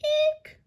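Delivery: very high squeal of joy; gleeful shriek. A narrator's reading. Crewman finding treasure, very high gleeful shriek. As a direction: shriek with glee. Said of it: "A gleeful shriek".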